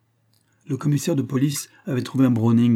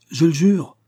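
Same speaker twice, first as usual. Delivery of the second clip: loud, high exclamation; crying out; 7 semitones higher, 6 dB louder than usual. A narrator's reading. Accused man protesting to the judge, crying out. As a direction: swear it out loud, desperately insistent. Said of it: "s'écria Roger".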